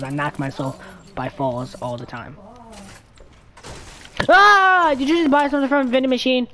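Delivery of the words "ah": sharp high yelp of surprise; a sudden startled cry; loud startled yelp; startled scream. Real, not acted. Sudden scream while gaming.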